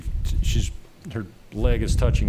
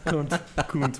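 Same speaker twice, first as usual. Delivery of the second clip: bad Manchester accent; 4 semitones higher, much slower than usual. Shown as a demonstration. Canadian repeating his friend's correction, clumsy Manchester accent. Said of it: "I do a bad Manchester accent".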